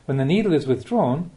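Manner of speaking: ordinary talk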